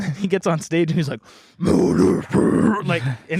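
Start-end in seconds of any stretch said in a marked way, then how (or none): 1.6-2.9 slow growled metal roar; growled scream. Retold or imitated. Singer opening the set, slow growled scream. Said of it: "he's just, like, growling".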